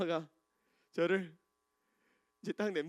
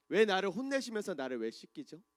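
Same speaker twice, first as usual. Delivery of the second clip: ordinary talk, nothing marked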